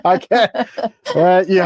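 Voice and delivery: talking through a laugh; tickled, barely able to get it out; real amused laughter. Real, not acted. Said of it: "without laughing".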